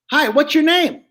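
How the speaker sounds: high, brash dummy voice; a cheeky puppet flirting loudly; Joey the dummy. Shown as a demonstration. Dummy flirting with the lady down front, high and brash.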